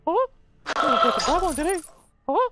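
squeaky high taunt; high hammy taunting voice. Pretend. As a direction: ham it up, way up high.

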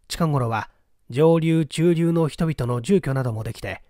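ordinary delivery

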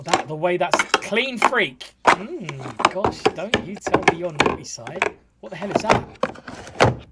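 ordinary delivery

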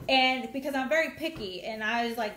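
ordinary delivery

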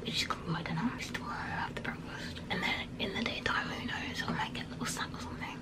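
ordinary talk, nothing marked

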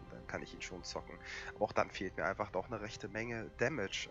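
ordinary talk, nothing marked

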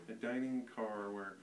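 ordinary delivery, nothing marked

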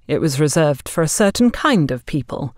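ordinary delivery

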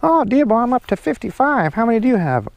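high teasing friend voice; a friend gloating, high; imitating Randizzle. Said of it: "I wish Randizzle was out here".

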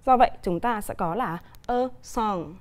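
ordinary talk

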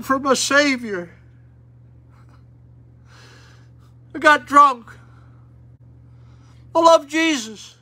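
high, loud pleading cry; imitating a wailing drunk. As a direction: act out the drunk's tearful confession. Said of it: "He'd be crying and weeping and wailing".

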